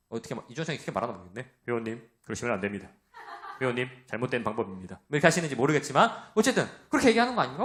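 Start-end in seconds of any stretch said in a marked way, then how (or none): 1.7-5.0 softer, lightly mocked trainer voice with a laugh; trainer's correction. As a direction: play the polite gym trainer correcting. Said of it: "이주원 청년 있으니까 이렇게". (트레이너가 회원에게 공손히 지적하는 흉내)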